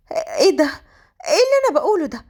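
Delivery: high-pitched, startled questioning; startled self-reproach. Acted. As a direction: catch yourself in disbelief, voice jumping up. Shocked at her own thoughts. (باستغراب من نفسها)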